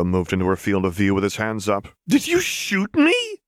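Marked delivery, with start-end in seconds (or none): 2.1-3.4 high, loud, slow furious question; snarled. Acted. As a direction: spit it at him in outrage. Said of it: "Lux snarled".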